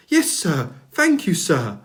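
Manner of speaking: eager, bright thanks; a pleased little engine thanking; Percy the new engine thanking the Fat Controller happily; happy. A narrator's reading. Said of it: "said Percy happily".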